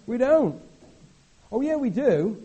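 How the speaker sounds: high, loud burst of mock enthusiasm; sudden excitement. Preacher bursts out to show the British do get excited. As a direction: jump up in pitch to prove the point.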